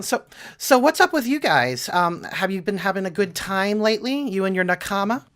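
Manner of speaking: high, chatty put-on character voice; overly sweet Watashi figure. Watashi asks Scarlet about her friends, high and overly sweet.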